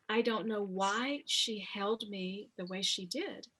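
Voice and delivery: normal talking voice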